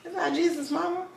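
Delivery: high, puzzled aside, "Is that Jesus' mama?" lifted up; surprised question. Retold or imitated. Retelling her church confusion to friends, confused high question. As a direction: ask it in confused disbelief. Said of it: "I was like".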